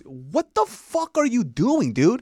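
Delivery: angry yell. Retold or imitated. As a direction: stretch out the outrage. Doctor yelling at friend.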